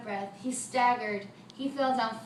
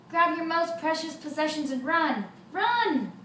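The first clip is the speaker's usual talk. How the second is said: higher, louder alarm call; yelled warning; 5 semitones higher, 6 dB louder than usual. Acted. Min warns the town to run for it, yelling. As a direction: shout at people to flee. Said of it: "Min yelled".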